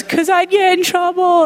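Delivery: put-on a small child owning up to the rule; little niece voice. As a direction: play the three-year-old who knows she's caught. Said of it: "And she goes".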